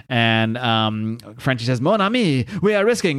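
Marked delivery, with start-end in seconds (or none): 1.8-3.2 put-on French accent. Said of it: "there, the French is back".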